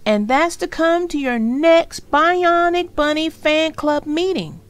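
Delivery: loud, high, put-on kid voice insisting; little sister insisting on her price. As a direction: dig in your heels. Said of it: "D W insisted".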